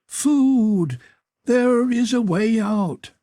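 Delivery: high, drawn-out maniac wail; keen it slowly at the top of the voice; shrilled. A narrator's reading. Crazed creature biting at a throat, shrilling. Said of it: "it shrilled".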